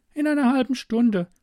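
put-on high, girlish voice; captive girl, matter-of-fact. Girl offers stranger coffee through the wall, high and matter-of-fact. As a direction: speak as an unbothered young girl, pitched high.

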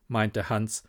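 plain reading voice

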